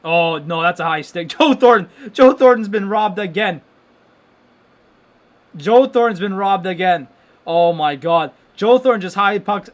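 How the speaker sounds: loud outraged fan yelling; getting excited. Real, not acted. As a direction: roar it out, laughing in disbelief. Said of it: "I need to get excited here".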